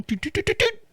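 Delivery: high, soft, climbing toots; sung-out dial tones; phone dialing noise. Retold or imitated. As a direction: imitate phone keys in rising little toots.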